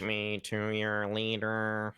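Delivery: slow, drawn-out alien greeting. Shown as a demonstration. Caster doing alien voice on co-caster's request, slow and strange. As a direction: stretch each word like a visiting alien. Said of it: "do your best alien voice".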